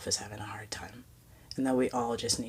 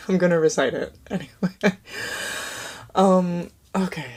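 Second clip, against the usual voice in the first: real laugh breaking through the words; laughing through tears; 5 semitones higher, 7 dB louder than usual. Real, not acted. Vlogger laughing at herself after choking up, real laughter. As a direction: laugh it off after nearly crying.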